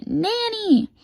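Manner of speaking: very high, long squealed echo; high drawn-out call; copycat scream. A narrator's reading. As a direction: mimic the tutor's scream for Nanny. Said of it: "And then I scream".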